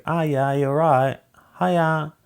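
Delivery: high, slow mock British dialect; exaggerated British accent, pitched well up. Shown as a demonstration. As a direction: try a broad British regional accent. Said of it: "the other one that's kind of like".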